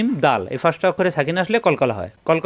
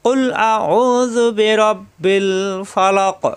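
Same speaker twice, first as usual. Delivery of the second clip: joined recitation; 2 semitones higher, much slower than usual. Shown as a demonstration. Teacher showing how to join the verse in one reading. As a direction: chant the verse through without stopping.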